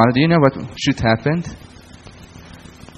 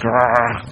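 dog snarl. Retold or imitated. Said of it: "runs like".

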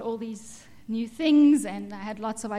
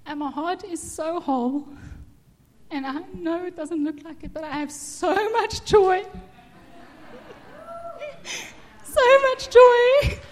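higher, tearful voice breaking with joy; crying, worn out; 8 semitones higher, 7 dB louder than usual. Real, not acted. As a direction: cry through it, louder toward the end. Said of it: "I can't stop crying yet".

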